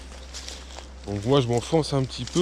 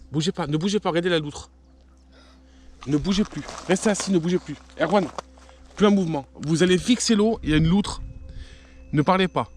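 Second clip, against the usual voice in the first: ordre pressant; 6 semitones higher than usual. Real, not acted. Ordres pressants en voyant la loutre.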